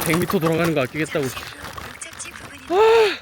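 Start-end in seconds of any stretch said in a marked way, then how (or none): 2.7-3.2 sharp yelp. Real, not acted. Sudden cry.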